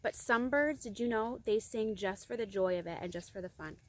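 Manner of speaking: ordinary delivery, nothing marked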